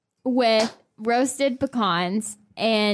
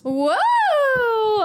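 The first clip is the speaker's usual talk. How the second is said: gasp, then a high, loud whoa; real surprise at the tied score; shocked gasp; 12 semitones higher, 5 dB louder than usual. Real, not acted. Shocked they are tied in the quiz.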